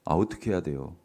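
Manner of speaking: a gentle nudge for an answer; coaxing repeat of the question. Shown as a demonstration. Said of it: "아이고, 안 들려".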